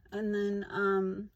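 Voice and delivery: ordinary talk, nothing marked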